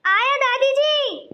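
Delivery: slow drawn-out call back to grandma; called reply. Acted. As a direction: stretch it out, calling from afar. (दादी को धीमे खींचकर आवाज़ देना)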